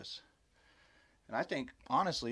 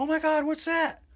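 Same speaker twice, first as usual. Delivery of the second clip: high, loud mock-alarmed exclamation; imitated shocked cry; 8 dB louder than usual. Susan spotting Bigfoot by a tree, imitated shock. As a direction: act out a startled friend calling out. Retells friend's shocked sighting.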